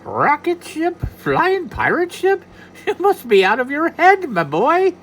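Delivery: high, loud, slow leprechaun; a tiny leprechaun mocking the idea; scoffing leprechaun.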